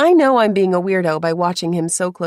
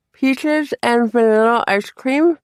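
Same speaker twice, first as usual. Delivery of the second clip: words through a cold numb mouth; 4 semitones higher, 3 dB louder, slower than usual. A narrator's reading. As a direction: speak carefully with a frozen mouth. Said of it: "my mouth is numbing further".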